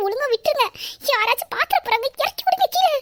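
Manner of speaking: high-pitched, giggly protest rising to a squeal; playful squeal of embarrassment; flustered squealing plea. Acted. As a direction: beg him to put you down, half laughing. Wife insists he put her down.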